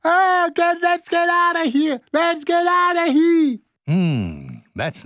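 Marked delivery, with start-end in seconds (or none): 0.0-3.6 very high, hammed-up yell to escape; mock panicked yelling. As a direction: yell it high, imitating someone fleeing a fire. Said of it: "you can hear [name] yelling".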